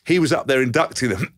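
ordinary talk, nothing marked

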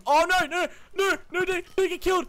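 high-pitched, frantic "no"s; alarmed panic. Real, not acted. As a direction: cry out as your teammate is in danger. Alarmed as teammate nearly gets killed.

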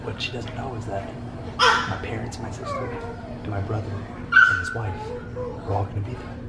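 soft secretive whisper. Real, not acted. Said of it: "you wanna know a secret".

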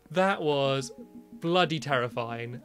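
ordinary talk, nothing marked

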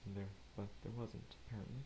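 normal talking voice

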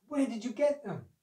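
eager wife. A narrator's reading. Wife questions crocodile about fruit.

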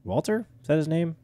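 Walter? rising high and doubtful; puzzled question. Real, not acted. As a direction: ask Walter? as if unsure of the name. Unsure of the character's name.

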